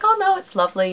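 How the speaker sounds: high, lilting quoted voice; mock high-pitched sweet protest; imitating gracious reply. Quoting a lovely celebrity's cheerful reply.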